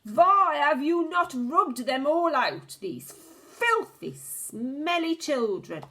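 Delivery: high, loud, slow furious rant; witch screaming. A narrator's reading. The Grand High Witch rants about the children, screaming. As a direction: build the disgust into a scream. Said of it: "she screamed".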